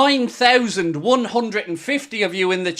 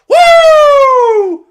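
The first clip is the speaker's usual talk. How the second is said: high loud woo of joy; ecstatic whoop; 10 dB louder than usual. Real, not acted. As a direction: let out a wild whoop. Celebration whoop.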